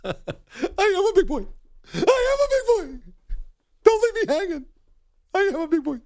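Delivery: high, silly proud voice; goofy high big boy. Retold or imitated. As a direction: squeal it like a proud kid. Repeated silly reply.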